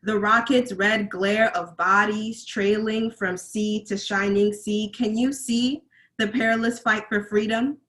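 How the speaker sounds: insistent spoken-word delivery, rising questions; poetry recitation. Acted. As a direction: press the questions on the audience. Recites second poem.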